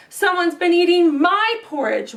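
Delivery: a hint of wailing mama bear; wailed. Mama Bear finds porridge eaten, mild wail. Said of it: "wailed Mama Bear".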